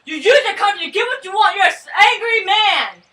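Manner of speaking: high, loud furious accusation; angry shout. Acted. Jacques shouting down Max in the argument, enraged. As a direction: blast him with it. Acted quarrel between revolutionaries.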